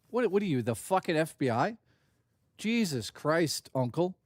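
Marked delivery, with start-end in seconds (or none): none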